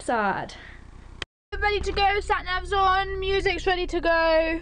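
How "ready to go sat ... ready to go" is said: higher, bright announcement of setting off; pumped to hit the road; chirpy ready-to-go call; excited. Real, not acted. Setting off in the car.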